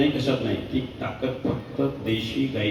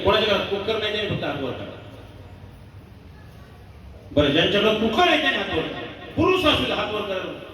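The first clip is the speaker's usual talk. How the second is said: preaching at full force; 7 semitones higher than usual. Acted. Kirtankar warning the audience about cancer, forceful preaching. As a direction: hammer home the figure to the crowd.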